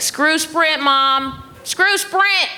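high-pitched acted rallying cry; punchy, high, worked-up urging; fired up. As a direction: rile mom up, pitch climbing high. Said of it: "got her all fired up".

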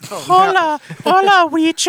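high, loud mock Mexican accent. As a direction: greet in a hammed-up Mexican accent. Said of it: "that's just an accent".

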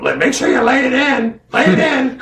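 squeaky imitation of a buddy giving orders. Nas giving instructions before match.

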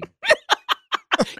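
unvoiced high giggle; high breathy laugh. Real, not acted. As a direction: giggle airily. Laughs at guest.

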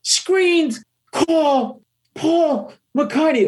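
high, frantic screamed curses; screaming. Retold or imitated. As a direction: scream in panic on the street. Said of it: "started screaming".

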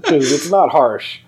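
high, laughing objection; laughing protest. Real, not acted. Protests "harsh" while laughing.

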